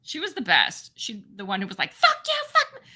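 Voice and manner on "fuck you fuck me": very high mock yell; imitated yelling. Neighbor yelling at her husband and kid, imitated high yell. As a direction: screech it like the yelling neighbor, without full volume. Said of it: "she'd be yelling at her husband".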